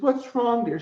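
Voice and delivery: a hint of a sweet old woman asking. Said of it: "and she said".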